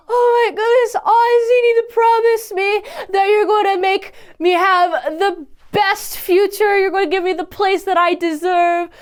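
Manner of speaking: high, hammed-up tearful pleading; mock crying. Mother begging her young son for a future, imitated tearful wail. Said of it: "but she's crying like".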